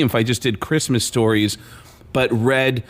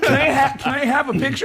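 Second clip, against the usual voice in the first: giddy pleading; 11 semitones higher than usual. Retold or imitated. Fan begging the quarterback for a picture, high and giddy. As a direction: plead for one more moment. Starstruck fan asking again.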